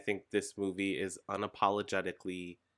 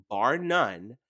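usual speaking voice

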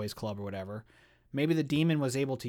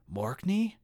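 ordinary delivery

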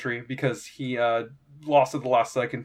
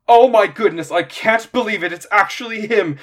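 higher, loud burst of amazement; starstruck fan; 7 semitones higher, 8 dB louder than usual. Acted. Quintus spots the famous fighter outside the arena, starstruck. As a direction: gush in awe at meeting your idol.

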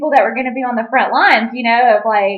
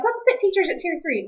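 higher, breezy official voice; high mock official; 10 semitones higher than usual. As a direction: chirp it like an oblivious official. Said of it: "they're like".

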